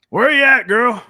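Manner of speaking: loud, high call across the room. Acted. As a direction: shout out to find her. Calling out for Naomi.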